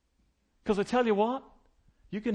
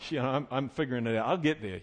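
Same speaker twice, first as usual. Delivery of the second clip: dismissive listener; 6 semitones lower than usual. Retold or imitated. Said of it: "And you go".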